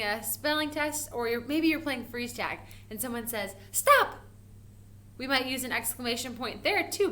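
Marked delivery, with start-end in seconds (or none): 3.7-4.2 sharp high yell of stop; urgent stop. Pretend. Playing freeze tag, calling out an urgent stop. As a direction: shout it to freeze someone. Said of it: "to stop".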